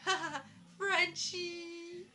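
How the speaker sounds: high, drawn-out mock laugh and jeer; teasing friend. Friend at the computer screen mocking her, high and drawn-out. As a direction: stretch it out high in playful mockery. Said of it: "she's sitting there at the computer screen going".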